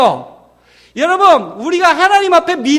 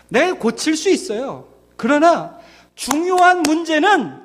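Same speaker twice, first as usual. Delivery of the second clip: ordinary talk, nothing marked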